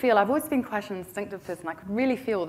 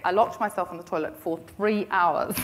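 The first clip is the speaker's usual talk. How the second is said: normal talking voice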